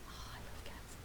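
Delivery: softly whispered aside; a hushed, unvoiced confession of love for cats. Real, not acted. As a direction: breathe it out like a secret. Soft aside about loving cats.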